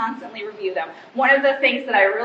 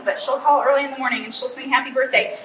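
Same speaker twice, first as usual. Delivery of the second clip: ordinary delivery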